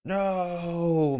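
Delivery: high drawn-out no; villain's long shout; yell. Acted. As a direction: yell it in dismay. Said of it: "Mysterio yells".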